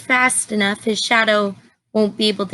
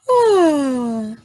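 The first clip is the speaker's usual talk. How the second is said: long weary sigh, voiced; tired sigh; 3 semitones higher than usual. A narrator's reading. Tired bear sighing before his nap. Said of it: "Bear was very tired".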